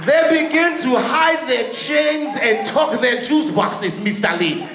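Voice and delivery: hammed-up spooky voodoo witch voice warning about the boy; African voodoo witch. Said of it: "an African voodoo witch".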